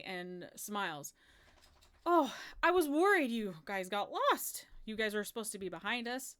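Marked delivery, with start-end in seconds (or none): 2.0-6.3 higher, louder exclamation of relief at the start; queen exclaiming over lost guests; relieved queen. Acted. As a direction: greet the stragglers with animated royal concern. Queen greeting the late party.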